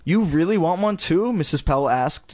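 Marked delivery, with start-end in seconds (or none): 0.0-1.3 higher, surprised mom's question. A narrator's reading. Mother asking her daughter about a second dummy, surprised. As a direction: ask it in raised, surprised disbelief.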